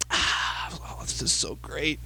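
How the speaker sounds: contented sigh. Retold or imitated. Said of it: "he's like".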